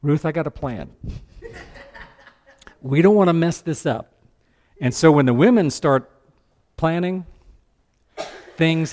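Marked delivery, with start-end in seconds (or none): 0.0-4.1 laughing. Acted. Naomi scheming with a laugh.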